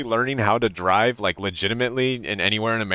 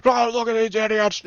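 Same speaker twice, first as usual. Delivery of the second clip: higher, hammed-up angry driver outburst; mock road rage; 10 semitones higher than usual. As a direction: play up cursing at the other drivers. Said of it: "people like me who's like".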